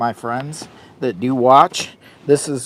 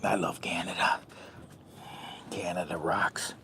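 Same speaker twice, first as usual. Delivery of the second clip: soft unvoiced whisper; 6 semitones lower, 13 dB quieter than usual. Real, not acted. Whispering fondly to the camera viewers. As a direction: breathe it like a secret. Quiet aside after Canada praise.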